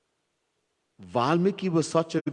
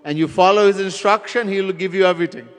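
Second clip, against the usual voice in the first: preaching at full force; 4 semitones higher, 6 dB louder than usual. Real, not acted. Preacher's climactic message.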